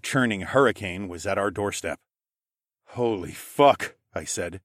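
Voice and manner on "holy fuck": slow, drawn-out disbelief; stunned swearing. Acted. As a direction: say it slowly, awestruck. Narrator faces the huge hurricane.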